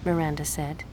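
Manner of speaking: ordinary delivery, nothing marked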